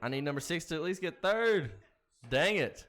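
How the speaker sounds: high, loud exasperated cry; frustrated shout. Real, not acted. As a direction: yell at the losing horse. Horse losing, frustrated.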